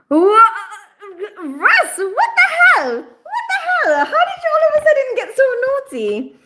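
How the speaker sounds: high laughing outcry of shock; shocked laughing shriek. Real, not acted. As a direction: shriek through laughter at a cheeky comment. Shocked at naughty chat comment.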